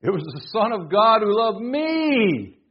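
"me" held long, high and loud; slow proclamation climbing to a drawn-out "me"; stress on "me". Shown as a demonstration. Preacher showing the emphasis that moves him most, stress on "me". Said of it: "the one that really gets me".

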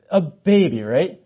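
ordinary delivery

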